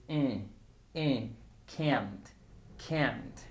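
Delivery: an open "eh" sound, then "can't" built on it; open vowel demo. Shown as a demonstration. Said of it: "agregar un sonido diferente al de schwa".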